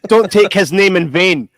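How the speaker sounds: loud, slow, high mock outrage; mock scolding. Scolding friends over a nun joke, in loud mock outrage. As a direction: belt it out slowly, word by word.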